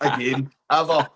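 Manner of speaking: loud, final, emphatic punch; hammed-up disgust at a terrible joke; mock outrage. Comic disgust at a bad joke.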